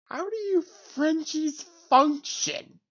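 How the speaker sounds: mock exasperation. Real, not acted. Mocking the French after a meme.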